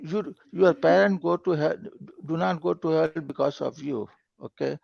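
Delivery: ordinary delivery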